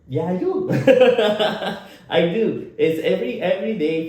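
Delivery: laughing it off, loud and high. Real, not acted. Laughing as he admits his thick accent to the host.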